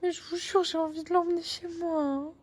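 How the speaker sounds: high, eager gushing; can't bear to leave it. Real, not acted. Telling viewers about the cat, high gushing.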